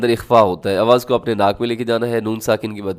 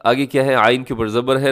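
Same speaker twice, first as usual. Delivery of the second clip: ordinary delivery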